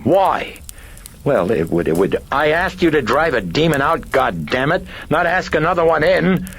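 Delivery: high, loud hysterical outburst; hysterical cry. A narrator's reading. Chris cries out at the priest in sudden hysteria, high and loud. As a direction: scream it at him, losing control. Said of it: "she cried at Karras in sudden hysteria".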